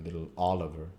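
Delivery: ordinary delivery, nothing marked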